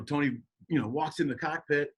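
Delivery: usual speaking voice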